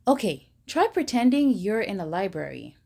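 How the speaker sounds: plain reading voice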